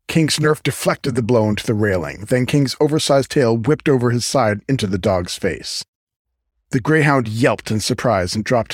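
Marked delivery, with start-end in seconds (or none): none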